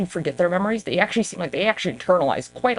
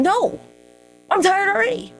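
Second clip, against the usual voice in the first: high-pitched whiny protest; hammed-up weary complaint; exasperated refusal; 13 semitones higher than usual. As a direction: refuse it in a much higher voice. Answers people asking about regression.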